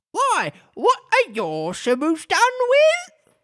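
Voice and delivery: very high, slow creature voice; drawn out and squeaky; Griffin. A narrator's reading. Griffin asks.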